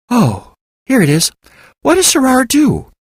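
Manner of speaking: a receptionist intrigued by a secret department; curious receptionist. A narrator's reading. Elizabeth finds the department.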